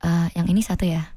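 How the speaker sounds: low, soft monotone, speaking Indonesian. Shown as a demonstration. Showing how she sounds at the booth, bahasa Indonesia datar dan pelan. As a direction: drop into a flat, soft mumble in Indonesian. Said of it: "this is how it sounds like".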